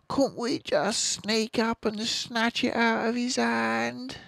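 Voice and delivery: high little cub voice; fox cub voice. Acted. Small fox to Dad, high eager ask.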